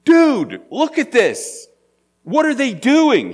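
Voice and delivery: high, loud, disbelieving dude; Paul aghast. Retold or imitated. Paul to Barnabas, seeing the crowd, aghast. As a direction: voice Paul as a startled modern guy. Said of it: "But now Paul's going".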